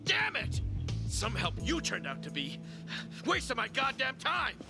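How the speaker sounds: high, furious storming-off rant; angry. Acted. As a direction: rage at him as you leave. Applicant storms off cursing.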